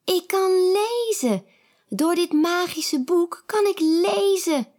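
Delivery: high joyful exclaim; excited cry. A narrator's reading. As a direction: burst out with delight. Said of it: "roept Kiki".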